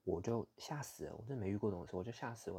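ordinary delivery

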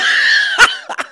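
high-pitched burst of laughter. Real, not acted. Laughing at a bullying debater, high laugh.

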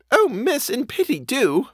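high-pitched, urgent plea; breaking out. A narrator's reading. Housekeeper begs the governess to send for uncle, urgent high plea. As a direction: burst out pleading, pitch well up. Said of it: "my friend broke out".

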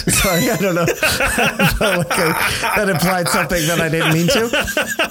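talking through real laughter; laughing apology. Real, not acted. Host apologizing for an unintended implication, cracking up. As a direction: laugh helplessly while trying to carry on.

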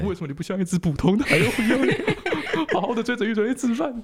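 hammed-up fretting parent voice; doting parent. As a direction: plead like a fussing mother, half laughing. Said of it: "看他这样很心疼".

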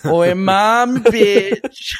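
high, cheeky Australian shout breaking into a laugh; Aussie call. Retold or imitated. Joining the Aussie correction, high laughing call. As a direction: yell it like a rude Aussie, laughing. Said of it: "it should be like".

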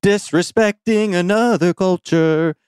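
high, drawn-out announcer voice; slow, hammed-up game show intro; mock show announcer. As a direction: announce it like a cheesy TV host.

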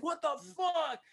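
high, mock outraged yelp; guy reacting. Lover hit by the lamp, mock outraged yelp. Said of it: "And he's like".

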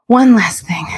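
whispered with relief; relieved whisper. Retold or imitated. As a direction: breathe it out in a whisper. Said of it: "I said it with such a".

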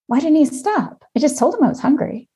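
high, exasperated inner complaint; fuming silently about her boyfriend; annoyed inner voice. Retold or imitated. Wife recalling her annoyance at boyfriend, high exasperated inner voice. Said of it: "and in my head".

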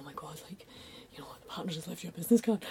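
half-whispered, awed gossip; hushed awe. Retold or imitated. Classmates passing on big news about a card, hushed. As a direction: drop to an excited near-whisper.

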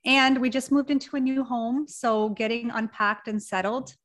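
ordinary delivery

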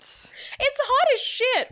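high, slow, stretched gush; excited gushing. Real, not acted. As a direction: draw it out high and thrilled. Gushing over romantic book scene.

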